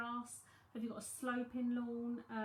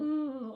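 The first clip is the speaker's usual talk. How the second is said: uneasy ooh; 4 semitones higher, 11 dB louder than usual. Retold or imitated. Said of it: "I walk in some houses and I go".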